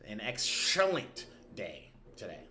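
excellent drawn out slow and punched; stress on excellent. Shown as a demonstration. Host shows viewers his emphasis on excellent. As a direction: lean hard on the word excellent, slowly. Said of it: "That means emphasis on the excellent".

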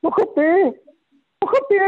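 high, lively showing-off; eager pointing. Retold or imitated. Pointing the prince to the rigging, high and eager. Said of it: "And then I went".

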